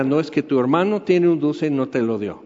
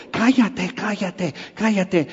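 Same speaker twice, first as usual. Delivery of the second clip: burla de hermanos que mandan callar, más agudo; hermanitos callando; 3 semitones higher, 4 dB quieter, slower than usual. Retold or imitated. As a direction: chistando con falsa piedad. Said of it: "así como hermanitos en Cristo". (burla de hermanos en Cristo que lo callan)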